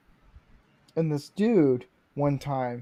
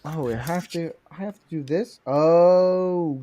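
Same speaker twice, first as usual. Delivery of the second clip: ordinary talk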